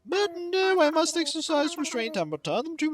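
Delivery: lilting, high-pitched courteous scholar; fussy museum host. Acted. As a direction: appraise grandly as a fussy expert.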